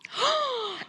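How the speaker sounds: high delighted gasp; excited gasp. Acted. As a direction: gasp with joy. Sees gnome friend.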